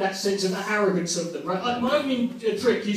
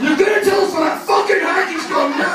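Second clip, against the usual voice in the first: shouted Scottish demand, pitched high; full-volume mock Scotsman interrogation; Angus McSporran; 8 semitones higher, 6 dB louder than usual. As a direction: roar it like a huge Scottish heckler demanding an answer. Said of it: "the biggest Scotsman in the world".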